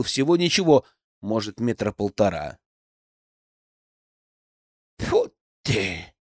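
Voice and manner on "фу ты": put-on creaky, grumbling old crone; croaky old woman voice. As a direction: croak it out like an old forest granny. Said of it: "кряхтящим старушечьим голосом".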